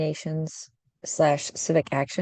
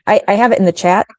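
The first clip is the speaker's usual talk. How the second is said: loud; 4 semitones higher, 10 dB louder, faster than usual. Real, not acted. Calling out over bad connection.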